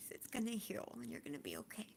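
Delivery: fighting tears, whisper it through; tearful whisper. Real, not acted. Vlogger comforts grieving viewers, whispering through tears.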